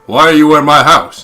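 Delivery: loud mock yell at the intruder; retold yell. Luke yelling at the stranger on his balcony, mock yell. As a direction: bark it out, half-joking. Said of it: "He was like".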